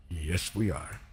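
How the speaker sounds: ordinary talk, nothing marked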